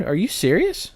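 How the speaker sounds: normal talking voice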